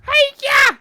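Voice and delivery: very high, loud karate cry; Miss Piggy. Pretend. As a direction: shriek it like a pig diva. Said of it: "That sounds like Miss Piggy".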